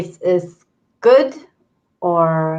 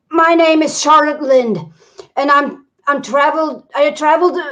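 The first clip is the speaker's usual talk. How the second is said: polite character voice; 5 semitones higher, 4 dB louder than usual. Acted. Player voices character Charlotte.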